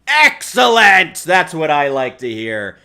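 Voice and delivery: loud, slow cheer; excited. Real, not acted. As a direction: bellow your approval. Cheering on a friend's bit.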